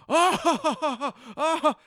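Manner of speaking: high mock Klingon ha ha; fake Klingon laugh. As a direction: hammer out nonsense Klingon syllables. Faking Klingon sounds.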